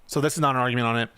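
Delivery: ordinary talk, nothing marked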